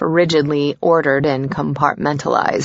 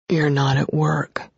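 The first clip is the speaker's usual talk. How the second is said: raspy sleepy voice; 3 dB quieter than usual. Acted. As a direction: rasp it out, sleepy and sensual. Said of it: "his voice raspier, sexier than usual".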